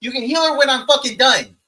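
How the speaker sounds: loud, high furious rant at the party; angry shout. Acted. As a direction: yell at them in character, fed up. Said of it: "I'm like".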